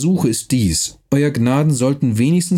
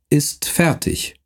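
"ist fertig" slow and higher after a long pause; 4 semitones higher, slower than usual. Acted. Count to Neumann, slowly finishing "ist fertig" after a pause. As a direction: let "ist fertig" fall after the wait. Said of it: "mit langen Pausen zwischen den Wörtern".

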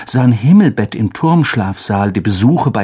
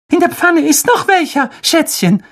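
much higher, sugary mother voice; doting mother. Acted. Mother cooing to her son, much higher. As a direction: coo at your darling boy.

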